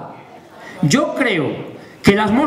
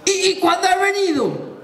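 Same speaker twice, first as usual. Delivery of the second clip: higher-pitched nosy villager question; vecino preguntón; 5 semitones higher than usual. Acted. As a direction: ask it high, like a prying local. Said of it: "luego te dicen". (vecino preguntón con voz más aguda)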